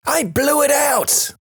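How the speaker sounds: high-pitched, drawn-out shout at normal volume. A narrator's reading. Daughter repeats it when asked "What's that now?", high drawn-out shout. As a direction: holler it slow and very high like a little kid.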